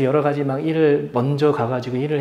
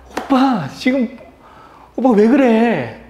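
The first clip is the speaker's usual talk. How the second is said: high, loud protest in the wife's voice; alarmed wife; 8 semitones higher, 6 dB louder, much slower than usual. Retold or imitated. Wife to husband who just yelled at their son, high dismayed cry. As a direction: voice a startled wife, stretching out the last words. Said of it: "그때 제 아내가".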